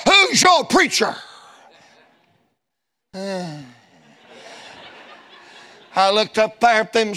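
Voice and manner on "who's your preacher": mock shout. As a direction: fire it at him. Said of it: "She said".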